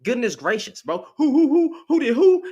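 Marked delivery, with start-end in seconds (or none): 1.2-2.4 high, loud disbelieving who; can't believe what he just read; sharp rising disbelief; incredulous outburst. Real, not acted. Incredulous outburst at a chat comment.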